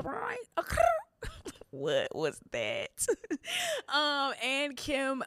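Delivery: laughing. Real, not acted.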